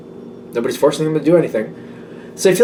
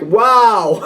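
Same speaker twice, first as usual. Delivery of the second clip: mock wow; 8 semitones higher, 3 dB louder than usual. Imitating a jolt of surprise.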